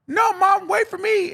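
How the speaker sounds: high, loud mock wail; desperate calling out. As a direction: cry after a fleeing mother. Acted daughter calling after fleeing mom.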